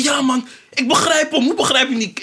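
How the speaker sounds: high-pitched imitation of an exasperated fan; mock indignant fan. Mimicking [name] fans who defend anything he says, indignant.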